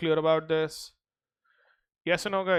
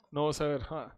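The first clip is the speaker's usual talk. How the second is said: ordinary talk, nothing marked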